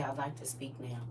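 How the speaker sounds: softly spoken calm request; quiet, clear request. Shown as a demonstration. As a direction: say it low and soft without mumbling. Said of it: "Don't mumble under your breath. Say".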